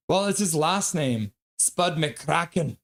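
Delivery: usual speaking voice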